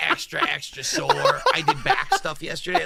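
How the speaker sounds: giggly mock re-read of being sore; laughing replay. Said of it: "repeating line laughing".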